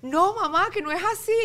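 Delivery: high, loud daughter's protest; a girl insisting her mom got it wrong. Retold or imitated. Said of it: "me río otra vez". (hija protestando en voz alta y aguda)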